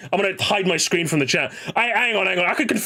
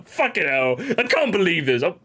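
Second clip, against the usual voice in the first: ordinary talk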